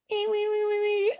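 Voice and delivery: high repeated "wee-wee" sound; thin, high mimicked squeal; high-pitched noise imitation. Imitates noise heard outside.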